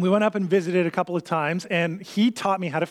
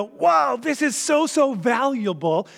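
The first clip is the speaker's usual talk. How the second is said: higher-pitched wow, stretched at the end; amazed discovery; 6 semitones higher, slower than usual. Acted. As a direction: act out stumbling on treasure, wowed. Acting out finder's amazed reaction.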